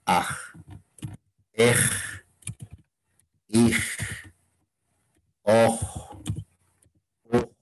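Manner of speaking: "aj, ej, ij, oj, uj" one at a time with long pauses; demonstrated word endings. Shown as a demonstration. Teacher dictating endings to learners, each of "aj, ej, ij, oj, uj" sounded out separately. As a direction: isolate each of "aj, ej, ij, oj, uj", breathing out the final j as a fricative. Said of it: "toda terminación".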